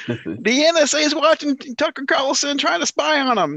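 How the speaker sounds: very high, slow mock whine; triggered Trump supporter. Trump supporter triggered about the NSA, high slow mockery. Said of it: "like a Trump supporter getting triggered".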